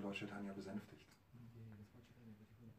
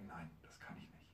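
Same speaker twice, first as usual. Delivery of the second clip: hushed refusal; 5 semitones lower, 9 dB quieter than usual. Acted. Angry Lord Chaitanya refuses.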